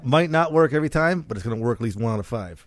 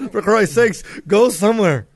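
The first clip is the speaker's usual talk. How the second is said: laughing exasperation; 8 semitones higher, 7 dB louder than usual. Pretend. Mock exasperation while laughing.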